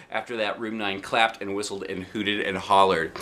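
usual speaking voice

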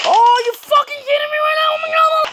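high, loud disbelieving cry; shocked outburst. Real, not acted. Gamer to his viewers, shocked and loud. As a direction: yell in real disbelief at the screen. Dying in a shooter game.

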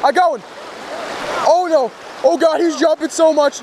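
high, thrilled shouts; excited shouting. Real, not acted. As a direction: yell with excitement. Excited fish on the line.

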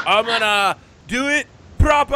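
loud, high wrestler catchphrase; Scowling Scotty. Acted. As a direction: belt out the big signature line, hammed up. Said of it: "And he's like".

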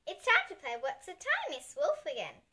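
usual speaking voice

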